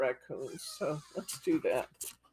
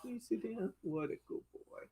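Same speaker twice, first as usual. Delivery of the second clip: much softer, coaxing the dog; soft coaxing pet talk; 6 semitones higher, 10 dB quieter than usual. Real, not acted. Owner softly coaxing her dog to sit.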